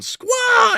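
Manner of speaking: high shouted bird squawk; mock eagle cry; eagle screech. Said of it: "that eagle's going".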